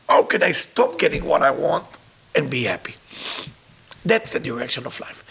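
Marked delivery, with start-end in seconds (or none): none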